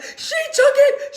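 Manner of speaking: high, loud, wailing protest; child howling that her sister took it. Retold or imitated. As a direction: cry it out at the top of the voice. Child wailing about the drink.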